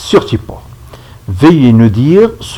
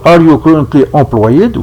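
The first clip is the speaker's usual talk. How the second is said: English question in a French-speaker's accent; accent français en anglais. Real, not acted. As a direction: read the English with francophone sounds. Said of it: "Je m'excuse de mon accent en anglais". (anglais prononcé avec l'accent francophone)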